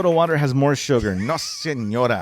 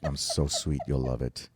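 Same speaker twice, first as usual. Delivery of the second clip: seductive praying voice; 8 semitones higher, 4 dB quieter than usual. Acted. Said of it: "he's saying it like this, like if he's praying".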